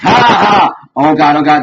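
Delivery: high, loud cackle tipping into panicky gasps; mock manic laugh. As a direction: cackle with relief, then panic. Retold manic laugh then panic.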